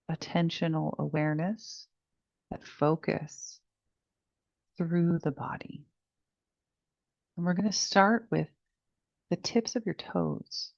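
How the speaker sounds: soft, unhurried meditation voice with long pauses; guided meditation. Real, not acted. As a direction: guide them gently through each breath, hushed and spacious. Leads the body scan meditation.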